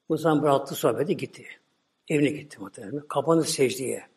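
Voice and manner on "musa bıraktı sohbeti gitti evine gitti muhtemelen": ordinary delivery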